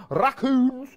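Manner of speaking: high, loud Scottish lilt; Scottish accent. Real, not acted. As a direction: burst into a Scottish accent on the word. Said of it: "I went Scottish then when I said raccoons".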